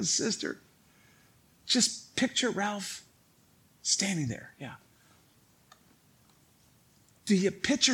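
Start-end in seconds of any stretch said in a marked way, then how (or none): 0.0-4.5 slow, softer whisper to a churchgoer; hushed whisper. Retold or imitated. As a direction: lean in and whisper it slowly. Quietly tells a church member.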